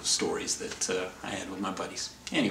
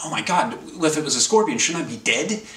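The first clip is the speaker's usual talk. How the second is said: panicked; 6 semitones higher, 5 dB louder than usual. Retold or imitated. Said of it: "I started freaking out".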